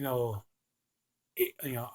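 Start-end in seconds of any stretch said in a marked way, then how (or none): none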